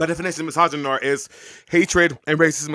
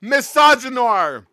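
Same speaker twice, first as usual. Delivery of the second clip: slow, loud, drawn-out declaration; loud proclamation; 7 semitones higher, 8 dB louder, much slower than usual. Real, not acted. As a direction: announce the word loudly. Loudly naming the term.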